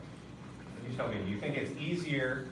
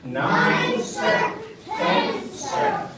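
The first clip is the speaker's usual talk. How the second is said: shouted drill count; 8 semitones higher, 8 dB louder, much slower than usual. Real, not acted.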